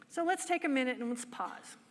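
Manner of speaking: ordinary delivery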